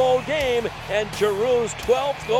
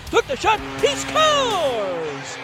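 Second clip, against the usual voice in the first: excited goal call; 6 semitones higher, much slower than usual. Real, not acted.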